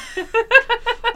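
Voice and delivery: loud high cackle; high loud laugh. Real, not acted. Laughs at own story.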